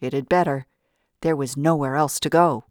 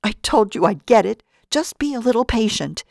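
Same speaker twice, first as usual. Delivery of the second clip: high, louder pleading whimper; 7 semitones higher, 4 dB louder than usual. A narrator's reading. Pearlie whimpering to Mick, scared and pleading. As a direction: beg him to wait, scared. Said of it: "Pearlie whimpered".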